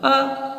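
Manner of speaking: अ said short and clipped; short vowel demo. Shown as a demonstration. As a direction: say अ quickly, a short sound. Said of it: "आपने बोला अ, आपको बहुत कम समय लगा". (छोटा अ)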